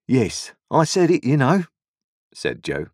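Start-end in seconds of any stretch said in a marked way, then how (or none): 0.1-1.6 Joe's voice. A narrator's reading. Joe voiced in story.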